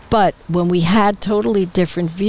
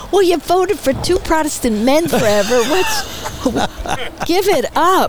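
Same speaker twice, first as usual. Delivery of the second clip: exasperated retort; 7 semitones higher, slower than usual. Retold or imitated. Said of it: "and I go".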